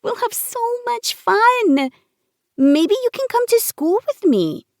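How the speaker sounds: put-on high, eager childlike voice; excited little girl. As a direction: bubble over like a happy child.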